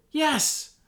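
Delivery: high, loud eager yes. Acted. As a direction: blurt a firm yes. Hobbit answers firmly.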